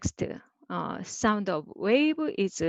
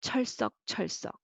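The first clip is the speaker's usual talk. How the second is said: 철썩 said fast twice, softer; quick repetition; 3 semitones higher, 5 dB quieter, much faster than usual. Shown as a demonstration. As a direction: run 철썩 together like repeating waves. Wave sound at running speed.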